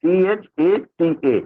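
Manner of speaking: "सीएचएटीए" spelled letter by letter, very slowly; slow dictation of letters; spelling it out. Shown as a demonstration.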